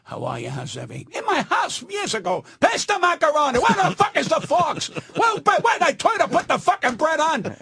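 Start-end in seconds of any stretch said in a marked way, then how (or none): 0.0-1.1 low, soft, restrained pleasantry; polite small talk. Shown as a demonstration. Showing the polite way he cannot talk, soft and low. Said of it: "I cannot say". 2.6-7.6 high-pitched, impatient dinner-table holler; household yelling. Retold or imitated. At the family table demanding macaroni, impatient yelling. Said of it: "to you it's yelling".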